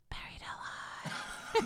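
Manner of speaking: slow, voiceless, eerie whisper; creepy whisper. Retold or imitated. Imitating old boyfriend scaring her in the dark, creepy whisper. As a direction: imitate a drawn-out spooky whisper. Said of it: "like, whisper at me".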